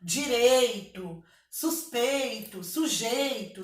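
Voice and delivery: each rhyme drawn out slowly; slow rhyme list. Shown as a demonstration. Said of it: "Posso usar porque rima com preconceito".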